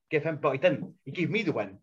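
ordinary talk

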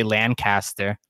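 "Lancaster" as LAN-cast-er; "Lancaster" as an outsider reads it, LAN-CASTER; wrong pronunciation. Shown as a demonstration. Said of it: "if you say it wrong".

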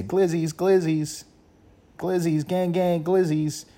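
high, stiff android chant; robot voice. Retold or imitated. Mocking a TikTok trend in a high robotic android chant. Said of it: "act like a, uh, an android, like a robot".

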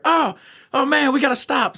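high, slow mock panicked inmate; lightly voiced frightened cellmate. Inmates hit by the stinger grenade, mild mock panic. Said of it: "and then you hear".

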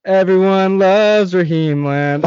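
high, slow, dumb little voice; goofy mock sing-out; Raheem. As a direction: draw it out loud in a silly little voice. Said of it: "Raheem's dumb little voice".

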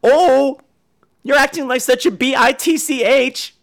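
high, prissy spelled-out fake curse; mock five-year-old. Adult fake cursing like a five-year-old, mock childish. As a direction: imitate a child tattling, letter by letter. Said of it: "fake cursing like they're five".

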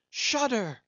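very high, loud cries; frantic lookout cry. A narrator's reading. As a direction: scream down from the mast.

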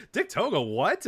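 high-pitched puzzled reaction, dropping on the last word; baffled disbelief. Retold or imitated. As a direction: imitate a stunned reader of the news. Voicing baffled tweets.

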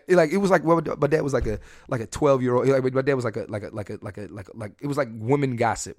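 ordinary talk

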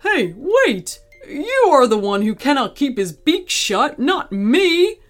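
high-pitched, indignant protest; protest, lifting sharply on the last words. Acted. Gorilla blaming talkative parrot, protesting. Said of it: "the gorilla protested".

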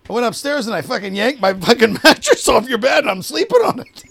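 high, loud mock defiance; retold defiant boast. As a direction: imitate a guy provoking his roommate. Retold defiant confession in a quarrel.

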